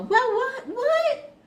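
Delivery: high, loud, disbelieving cry; can't believe what they're hearing; a yelped, high what in disbelief; incredulous. Real, not acted. Mocking disbelief at grudge-holder.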